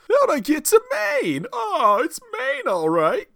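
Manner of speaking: very high, loud cartoon New England accent; mock Maine accent. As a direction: squeak it out like a giddy Mainer. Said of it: "accent is just gonna bleed over".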